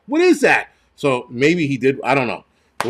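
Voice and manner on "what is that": high, loud cry of a bystander; imitated yell. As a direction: yell it like someone in the crowd. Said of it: "heard somebody yell".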